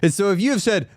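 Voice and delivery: ordinary talk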